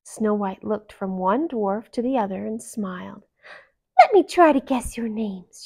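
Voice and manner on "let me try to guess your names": playful princess voice. Acted.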